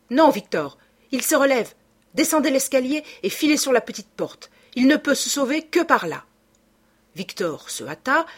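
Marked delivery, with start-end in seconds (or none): 0.1-6.2 urgent orders. A narrator's reading. Raymonde giving urgent orders.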